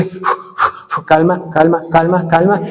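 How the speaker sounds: breathy puffs, then calming himself; catching his breath. Retold or imitated. Pastor hears he was poisoned and puffs to calm himself. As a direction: blow out the shock and settle yourself.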